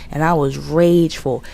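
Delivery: ordinary talk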